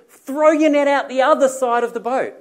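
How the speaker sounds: high, loud holler over distance; calling out. Acted. Man on shore telling fishermen where to cast, calling out. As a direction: shout the instruction out to the boat.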